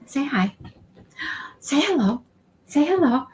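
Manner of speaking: high coaxing voice; pet talk. Real, not acted. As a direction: coax the cat to say hello. Coaxing cat to greet viewers.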